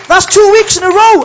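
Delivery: loud, teasing royal announcement; the King's loud joke. Acted. The King teases the worshipper, loud. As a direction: boom it out like a king ribbing them. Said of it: "And the King says".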